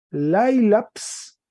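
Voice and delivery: Lailaps said high and loud; teacher voices Lailaps for the class; Greek nominative modeled. Shown as a demonstration. Teacher reads Greek word aloud to students, emphatic. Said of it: "un acento circunflejo".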